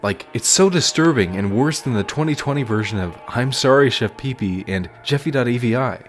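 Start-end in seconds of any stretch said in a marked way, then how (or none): none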